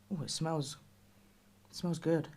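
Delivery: normal talking voice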